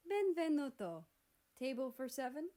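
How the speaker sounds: a hint of a hammed-up Italian host accent; a light Italian waiter accent on "Table for seven". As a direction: greet them like an Italian chef, with the Italian accent. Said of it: "spoke in an Italian accent".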